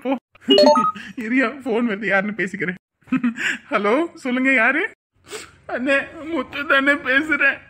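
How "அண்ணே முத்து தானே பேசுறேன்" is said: slow, sob-laden phone voice; crying. Acted. Muthu phones an elder brother with bad news, crying. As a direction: struggle to speak through tears. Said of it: "ஏன் அழுவுற?".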